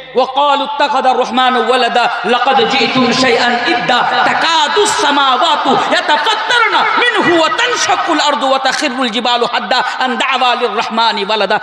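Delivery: fluent Arabic verse recited in a sermon; Quran recitation. Real, not acted. Preacher citing Surah Maryam as proof to the congregation, Quran recitation. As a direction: recite scripture with a rising and falling cadence.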